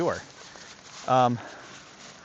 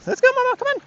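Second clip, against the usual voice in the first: much higher, louder dog praise; high-pitched praise; 4 dB louder than usual. Real, not acted. Trainer praises his dog crossing, squeaky high praise.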